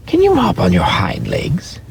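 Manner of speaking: high, teasing rabbit voice; doubtful wild rabbit. A narrator's reading. Wild rabbit doubting the toy rabbit, high and teasing. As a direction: needle him, a little sceptical.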